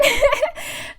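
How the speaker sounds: high, loud burst of laughter; amused high cackle. Real, not acted. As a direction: laugh out loud, pitched right up. Laughs about grandmother keeping kittens.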